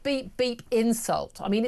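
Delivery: ordinary delivery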